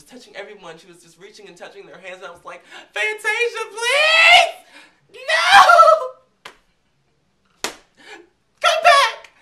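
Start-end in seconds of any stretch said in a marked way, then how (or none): 2.9-9.3 high, loud laughing-crying plea; desperate fan shriek. Retold or imitated. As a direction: beg her to come back, screaming and laughing. Begging the singer to return.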